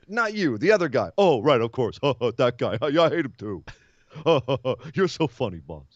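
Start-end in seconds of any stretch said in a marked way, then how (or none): 1.2-5.9 dopey laughing sidekick. Retold or imitated. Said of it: "sidekick laughing along".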